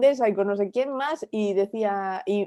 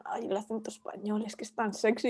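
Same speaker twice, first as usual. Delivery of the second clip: mock gushing American; 11 dB quieter than usual. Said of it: "Siempre dicen".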